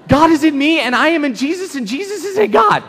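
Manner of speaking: high, loud, breathless shout of discovery; excited yell. Retold or imitated. Telling dad the verse finally clicked, shouting. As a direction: blurt the revelation out to dad. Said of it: "I yelled".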